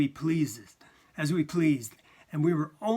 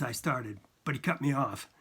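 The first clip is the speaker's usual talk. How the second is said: ordinary delivery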